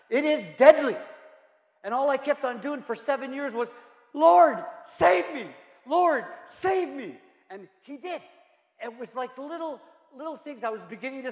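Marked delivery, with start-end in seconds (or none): 4.1-7.2 high plea, slowing to a drag; imitating his own desperate prayer; retold plea. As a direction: remember begging for rescue, slowing down.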